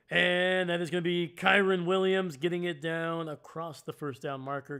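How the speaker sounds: energetic sportscaster call; excited play-by-play. Real, not acted. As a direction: stretch the opening word, then drive through the gain. Live play-by-play of a catch.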